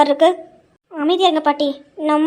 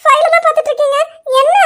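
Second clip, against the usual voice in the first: high, raised-voice disbelief; indignant and sharply pitched up; outraged; 8 semitones higher, 3 dB louder than usual. Acted. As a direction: fling his insult back in outrage. Wife outraged at being dismissed.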